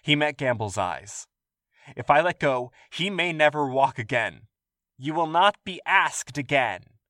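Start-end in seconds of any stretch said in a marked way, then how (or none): 1.9-4.5 urgent plea. Acted. William pleading. 5.0-7.0 slow stern warning. Acted. Guard final warning.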